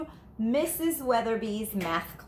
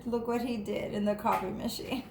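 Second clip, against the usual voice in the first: plain reading voice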